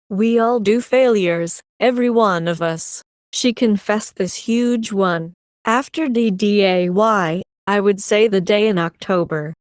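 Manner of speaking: ordinary delivery, nothing marked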